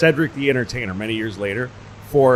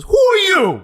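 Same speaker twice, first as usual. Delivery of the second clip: shrill, much higher and louder demand; suspicious receptionist; 10 dB louder, slower than usual. Retold or imitated. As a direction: snap it like a wary receptionist.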